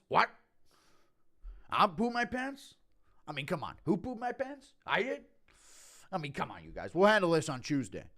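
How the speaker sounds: dazed promoter impression, questions leaping high; Dana. Promoter on mushrooms, bewildered impression swooping high. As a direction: squeal the questions, then drop to fobbing people off. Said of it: "Impression of Dana on mushrooms".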